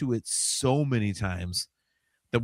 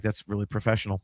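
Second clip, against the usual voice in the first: ordinary delivery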